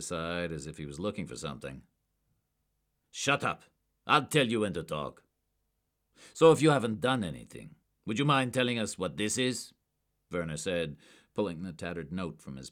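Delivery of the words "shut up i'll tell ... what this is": loud, higher, cutting; harsh interrogation. A narrator's reading. Agent cutting off a suspect, harsh and loud. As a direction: cut him off hard.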